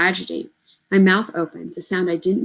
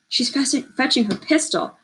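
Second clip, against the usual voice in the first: high, urgent frightened warning; 7 semitones higher than usual. Acted. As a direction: warn them in a rush of fear. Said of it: "warns Simeon".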